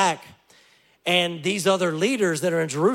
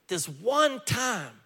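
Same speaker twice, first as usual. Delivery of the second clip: slow, higher, stretched-out begging; drawn-out plea; 5 semitones higher, much slower than usual. Retold or imitated. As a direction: drag it out like a desperate bargain. Fisherman bargaining with God.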